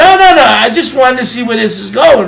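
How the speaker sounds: high, loud burst of no's, easing off; retold urgent protest. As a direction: cut in loudly with an acted objection. Acted protest in retold exchange.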